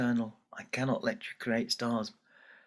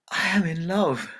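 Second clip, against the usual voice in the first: raised, louder bright declaration; bold declaration; 6 semitones higher, 5 dB louder than usual. Acted. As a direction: announce it proudly to her parents. Daughter declares love to parents.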